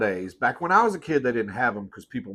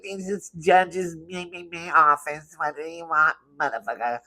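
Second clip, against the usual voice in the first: high, put-on receptionist voice; mock a court clerk answering the phone; court lady impression; 6 semitones higher than usual. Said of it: "they always sound like that".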